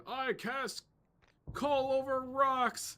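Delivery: loud, very high, drawn-out card announcement; hammed-up anime battle cry; Yu-Gi-Oh duelist. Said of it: "in Yu-Gi-Oh! they would say".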